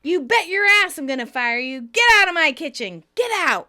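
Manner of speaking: loud, high "Get out" yelled; furious shout. Retold or imitated. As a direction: throw him out of the kitchen, loud and sharp. Monica fires Joey, shouting.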